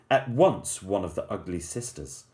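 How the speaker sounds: plain reading voice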